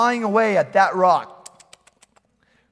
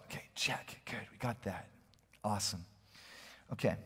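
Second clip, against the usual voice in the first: breathy whispered, self-satisfied ticking off; a smug churchgoer whispering it's all handled; smug whispered checklist; 11 semitones lower, 18 dB quieter than usual. Pretend. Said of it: "some of you are looking at this and saying".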